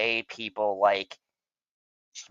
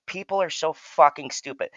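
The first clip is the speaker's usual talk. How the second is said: "People are so fucking stupid" launched higher as an announced rant; rant opener; 7 semitones higher than usual. Real, not acted. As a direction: kick off the rant with a fired-up jab. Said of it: "people like a [name] rant, so here it goes".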